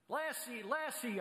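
high, happy calling of the dog's name; joyful cry. Retold or imitated. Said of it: "I cried".

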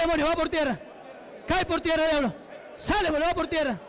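forceful, high-pitched deliverance commands; exorcism preaching. Real, not acted. Preacher to a demon he is casting out, commanding. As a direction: order the demon out in rapid, insistent bursts.

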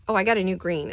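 normal talking voice

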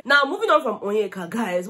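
normal talking voice

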